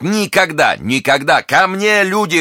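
very high-pitched cry for help; alarmed exclamation. A narrator's reading. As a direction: cry out in defiance, then summon help. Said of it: "воскликнул герцог".

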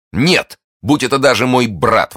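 loud, high, rapturous vow; burst of rapture. A narrator's reading. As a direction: exclaim it as if carried away. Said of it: "точно в порыве восторга". (громко, в порыве восторга)

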